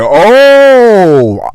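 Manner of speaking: long, high, loud "oh"; acted dawning realization. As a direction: draw out the oh as it clicks. Retold realization about who the woman is.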